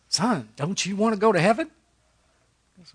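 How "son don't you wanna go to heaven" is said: higher, kindly Georgia preacher asking; Georgia accent. Retold or imitated. Preacher coaxing the one little boy, in a higher Georgia accent. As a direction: imitate the old preacher's Georgia lilt, pitched up. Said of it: "with that thick Georgia accent".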